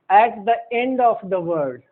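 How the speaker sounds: slow, word by word; slow dictation. Shown as a demonstration. Teacher dictating the rule to students slowly. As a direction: spell it out slowly for notes. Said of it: "teacher dictating the rule".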